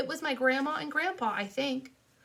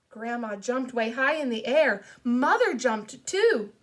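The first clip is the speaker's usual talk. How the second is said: ordinary delivery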